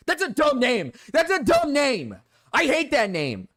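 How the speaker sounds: high, loud mock-rude rant; mock outburst. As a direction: blurt out a fake angry insult. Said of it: "I'm sorry, that was rude".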